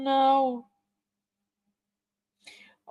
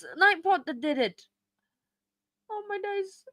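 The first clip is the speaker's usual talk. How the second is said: ordinary talk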